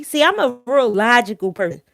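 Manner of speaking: normal talking voice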